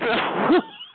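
brief high-pitched laugh; short laugh. Real, not acted. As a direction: let out a quick high laugh. Amused by the recording.

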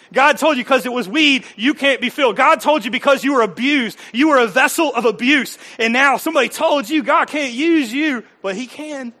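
fervent pulpit delivery; impassioned preaching. Real, not acted. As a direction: press hard on the hurting listener. Preaching build.

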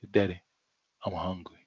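low, hushed request; soft child voice. Retold or imitated. Child quietly telling sleeping father he is hungry. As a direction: whisper it to a sleeping dad.

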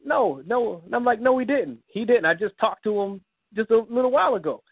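high, pleading disbelief; high broken denial of a death; distraught denial. Retold or imitated. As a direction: refuse to accept the news, voice up high. Denying news of brother's death.

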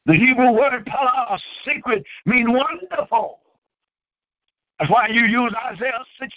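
fervent preacher voice rising and falling; preaching. Acted. Preacher urging viewers to forgive God, preaching. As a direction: preach it to the congregation.

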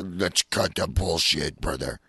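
Macho Man. Retold or imitated. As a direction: drop low and drag it like the wrestler. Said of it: "I could see Macho".

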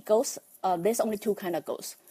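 ordinary talk

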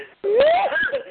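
high whooping laugh. Real, not acted. Teased friend laughing off a scolding, high whoop of laughter. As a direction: crack up high and hard.

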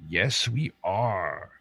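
ordinary delivery

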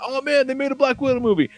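high-pitched gushing fan; mock-awed, high-voiced enthusiasm; mock excitement. Mimics enthusiastic people talking about it.

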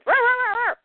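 high mock dog growl, rawr rawr; imitating a frantic dog's growl. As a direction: play the angry dog barking at the stranger. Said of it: "Crypto's going nuts".

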